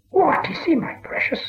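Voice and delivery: high, unvoiced hiss; curious creature whisper to himself; creepy whisper; Gollum whisper. A narrator's reading. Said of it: "whispered Gollum".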